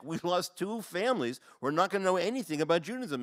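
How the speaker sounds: impassioned retold protest. Counselor's retold impassioned correction of rabbi.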